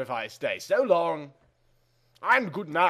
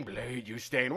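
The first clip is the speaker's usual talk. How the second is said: softer, lower echoed repeat; quiet echo; 4 semitones lower, 10 dB quieter than usual. Acted. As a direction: echo it back faintly. Echo of the line before.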